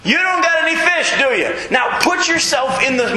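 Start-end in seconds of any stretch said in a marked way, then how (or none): 0.0-1.6 high, mocking yell; taunting stranger. Shown as a demonstration. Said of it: "That's literally how it reads".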